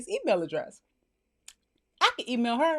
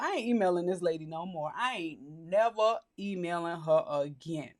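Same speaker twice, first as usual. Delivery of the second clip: low, soft, slowing to deliberate words; resolute vow; 6 semitones lower, 6 dB quieter than usual. Acted. Acted private vow, offended.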